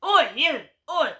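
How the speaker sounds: loud rude call, summoning; shouted oi. Retold or imitated. Woman shouting at vlogger on balcony, loud summons. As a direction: yell it like ordering a possession. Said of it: "Like you're some sort of possession".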